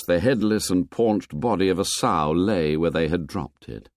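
ordinary delivery, nothing marked

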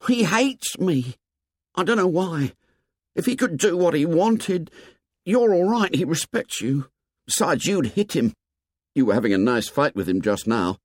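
put-on high, anxious boy's voice; high-pitched, worried explaining; Piggy fretting. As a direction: fret in a high boyish voice.

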